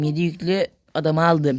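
ordinary talk, nothing marked

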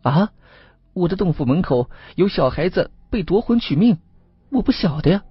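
high-pitched shocked fox spirit; astonished, high-voiced denial; greatly startled. A narrator's reading. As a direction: react with alarm and plead ignorance. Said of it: "那位黄仙听了是大吃一惊".

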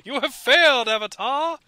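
hammed-up villain proclamation; Vatu. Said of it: "the deep voice like".

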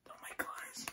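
soft, voiceless gasp of words; awed whisper. Real, not acted. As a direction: whisper it, overcome with amazement. Overwhelmed by the pages.

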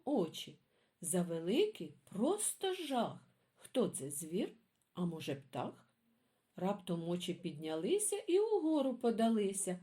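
put-on lively sing-song poem reading for kids; reading poems to children. A narrator's reading. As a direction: read it like a bedtime rhyme for little ones. Reads children's poems aloud.

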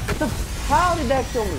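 loud, high, outraged shout; raging gamer. Real, not acted. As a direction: yell at the screen in disbelief. Baffled by own death.

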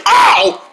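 loud high yelp of pain. Acted. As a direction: yelp as the finger hits. Hurt finger on crate.

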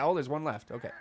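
ordinary delivery

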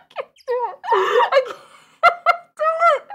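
high, loud words squeezed through laughter; laughing helplessly. Real, not acted. Giving up on the voice, high laughing wail. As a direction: wail it while cracking up. Breaks down over the voice request.

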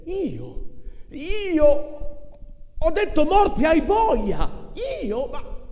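high, loud indignant protest, slow; strangled disbelief from the old vendor; choked outcry. Acted. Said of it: "gridò con le braccia incrociate". (grido strozzato e incredulo, alto e forte)

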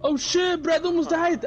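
ordinary delivery

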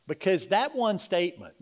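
normal talking voice

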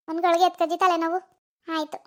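usual speaking voice

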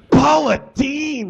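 slow, high, furious roar of a name. Acted. Head chef roaring at Paula over her dish, furious. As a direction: bellow her name across the kitchen. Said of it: "he roared".

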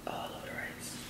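soft breathy whisper. Real, not acted. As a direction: whisper it to yourself. Whispered repeat while cooking.

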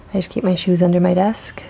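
usual speaking voice

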